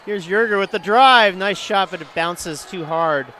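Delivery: sudden high, loud burst on the drive; rising play-by-play excitement; excited call. Real, not acted. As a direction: punch the call as the play breaks.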